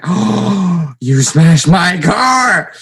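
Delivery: loud, high, drawn-out outraged yell; gasp, then bellow like a furious dad; hammed-up imitation of an upset father; pissed-off dad. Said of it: "he was really pissed".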